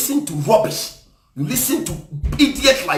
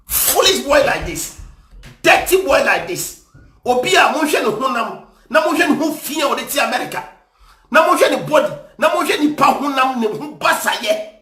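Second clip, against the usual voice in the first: raised, higher-pitched scolding; angry rant; 5 semitones higher than usual. Real, not acted. Commentator insulting the man in the clip, angry rant. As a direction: berate him in a heated, rising voice.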